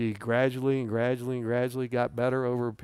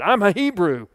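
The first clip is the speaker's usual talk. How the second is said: high, louder protest of belonging; raised voice, acting out Jonah's protest; indignant claim; 7 semitones higher, 5 dB louder than usual. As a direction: insist you're one of his people. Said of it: "I'm like Jonah said".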